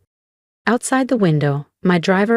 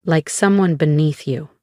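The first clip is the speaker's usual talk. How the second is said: ordinary delivery, nothing marked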